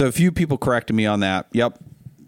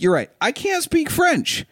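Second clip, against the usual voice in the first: mock high concession; 13 semitones higher than usual. Replying to a commenter, high and slow mock surrender. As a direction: voice pitched way up, drawn out slow. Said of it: "I'm like".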